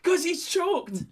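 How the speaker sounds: high, drawn-out crowd call; crowd shout-back. Acted. Mimicking a battle crowd finishing the line, high drawn-out call. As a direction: shout it back like a hyped audience. Acts out everyone's chanted reply.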